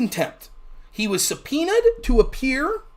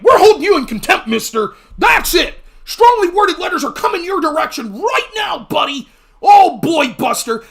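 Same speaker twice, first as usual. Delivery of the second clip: higher, louder mock scolding; hammed-up toothless stern warning; blustering Republicans; 5 semitones higher, 5 dB louder than usual. Republicans scolding Hunter in mock bluster, high and loud. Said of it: "And they'd be like".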